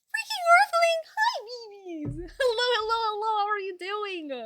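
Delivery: high, loud laughing hello; squealing greeting. Real, not acted. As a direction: squeal hello at the new arrivals. Greeting new viewers with squeals.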